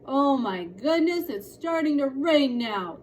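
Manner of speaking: slow, loud despairing groan. A narrator's reading. Father despairs at the rain on his garden, slow and despairing. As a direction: lament the rain. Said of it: "their father said despairingly".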